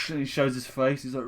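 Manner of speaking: ordinary talk, nothing marked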